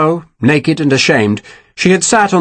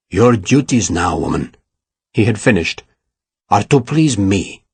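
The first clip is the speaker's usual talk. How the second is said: low menacing villain; 5 semitones lower than usual. A narrator's reading. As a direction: lean in and savour it. Ferragus threatens Sarah.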